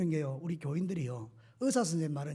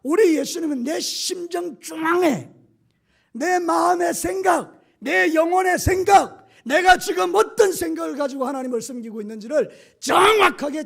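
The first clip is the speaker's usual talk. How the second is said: slow shout, much higher and louder; slow, building shout; 9 semitones higher, 11 dB louder than usual. Acted. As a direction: hammer each phrase out slowly at full force. Preacher's climax. (천천히 높아지는 외침)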